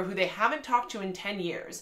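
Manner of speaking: ordinary talk, nothing marked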